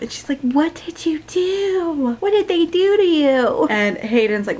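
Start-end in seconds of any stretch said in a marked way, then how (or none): 0.4-3.7 high, slow worried questions. Retold or imitated. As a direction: ask it anxiously.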